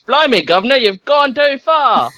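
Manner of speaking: high, slow mock Cockney; a hammed-up Cockney cabbie squeak; Cockney GPS. GPS set to Cockney English, high mock Cockney. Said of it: "Put it to Cockney English".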